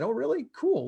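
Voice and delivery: high, delighted mock surprise; excited surprise. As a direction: imitate her perking up at good news. Eager reply to more properties.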